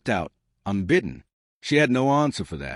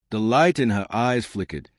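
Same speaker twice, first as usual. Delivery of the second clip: ordinary delivery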